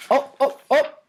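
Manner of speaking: ordinary delivery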